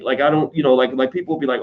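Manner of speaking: ordinary talk, nothing marked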